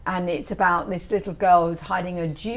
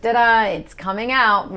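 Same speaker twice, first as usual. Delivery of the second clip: mock triumphant; 4 semitones higher, 6 dB louder than usual. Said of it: "I said".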